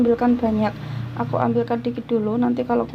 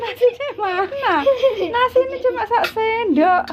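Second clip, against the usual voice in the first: laughing; 11 semitones higher than usual. Real, not acted. Mother laughing at tiny rice portion.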